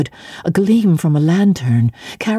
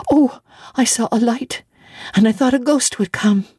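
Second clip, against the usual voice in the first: put-on frightened child; 6 semitones higher than usual. Child explains her fright.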